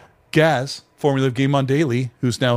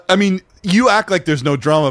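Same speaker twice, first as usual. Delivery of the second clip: normal talking voice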